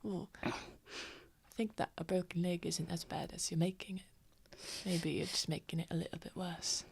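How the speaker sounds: soft, whispery voice; quiet in character. Acted. Tavamil teases Lucian about his broken leg, whispery quiet. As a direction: murmur it close, half-whispered.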